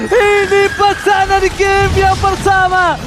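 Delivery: loud, high, drawn-out hype calls; hype caster shout. Acted.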